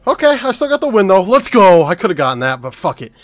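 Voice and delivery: loud triumphant celebration; excited cheering. Real, not acted. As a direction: hype yourself up after the win. Celebrating the round win.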